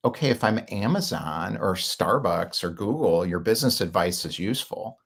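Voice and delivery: ordinary delivery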